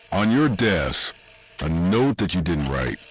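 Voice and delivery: ordinary delivery, nothing marked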